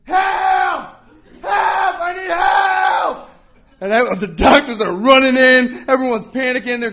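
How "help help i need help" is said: high, loud, slow cry for help; shouting for help. Retold or imitated. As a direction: yell for help in fake panic. Old man fakes emergency shouting.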